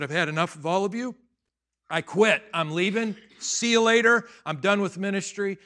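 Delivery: loud, exasperated retold resignation; fed up. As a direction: announce a walkout to the whole room. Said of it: "He was mad at, he was mad at his congregation".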